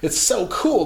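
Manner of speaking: ordinary talk, nothing marked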